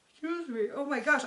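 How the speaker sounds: high, hushed words caught in a yawn. Real, not acted. Host can't stop yawning during recap, talking mid-yawn. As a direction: yawn your way through it. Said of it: "I can't stop yawning".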